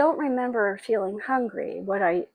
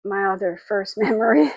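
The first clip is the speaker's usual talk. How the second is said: laughing; 8 dB louder than usual. Real, not acted. Laughs mid-sentence in emotional recollection.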